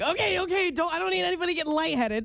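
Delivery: high, hurried mock fretting; retold flustered warning; worried retelling. Retells stopping helpful kids.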